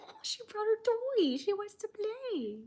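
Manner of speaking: high sing-song pet voice. Real, not acted. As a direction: coo at the puppy. Talking about dog in pet voice.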